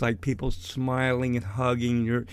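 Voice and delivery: ordinary talk, nothing marked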